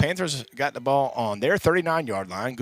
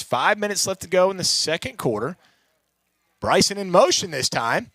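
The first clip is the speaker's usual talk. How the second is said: ordinary talk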